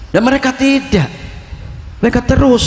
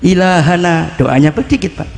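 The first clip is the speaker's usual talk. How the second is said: ordinary delivery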